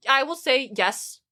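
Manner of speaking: normal talking voice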